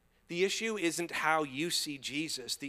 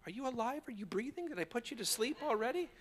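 high, quick, softer mock concern; teasing check-in; 10 semitones higher, 4 dB quieter, faster than usual. Real, not acted. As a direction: prod a sleepy crowd in a high voice. Teasing an unresponsive congregation.

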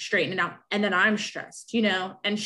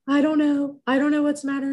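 higher, louder imitation of crying upset; mock-distraught, pitched up and pushed; retold tearful upset; 7 semitones higher, 5 dB louder than usual. New mother crying to her mom, retold as tearful upset. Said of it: "I was crying".

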